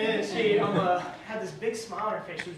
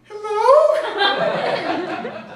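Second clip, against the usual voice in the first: laughing hello; 12 semitones higher, 12 dB louder than usual. Retold or imitated. Answering girl's call, nervous.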